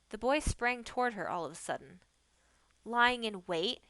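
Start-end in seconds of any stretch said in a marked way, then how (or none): 2.8-3.8 a raised, playful cry. A narrator's reading. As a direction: call out to startle her. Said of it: "he cried".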